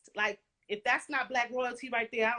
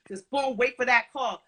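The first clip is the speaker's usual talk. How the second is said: ordinary delivery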